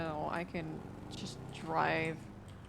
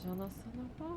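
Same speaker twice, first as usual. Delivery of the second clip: French; 4 semitones higher, 7 dB quieter than usual. Shown as a demonstration. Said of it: "I said something in French".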